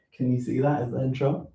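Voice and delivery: usual speaking voice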